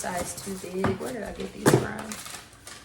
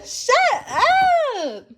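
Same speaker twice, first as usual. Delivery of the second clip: squealing; 11 dB louder than usual. Real, not acted. Repeat of shut up, higher.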